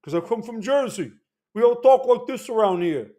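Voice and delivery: playing up a lightly heavier New Jersey accent; mild New Jersey accent demo. Shown as a demonstration. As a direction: lean into the Jersey accent a touch. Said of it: "It's why I speak funny also".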